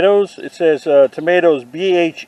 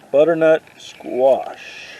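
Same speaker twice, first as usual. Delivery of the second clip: savouring the name; much slower than usual. Pretend. Repeats name slowly, savouring.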